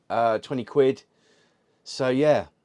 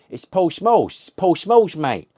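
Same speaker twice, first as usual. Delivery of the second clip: high, loud mock shout of the name; imitating the mate's shout; 9 semitones higher, 8 dB louder, slower than usual. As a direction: shout it out like his mate from the car. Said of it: "as to why I say".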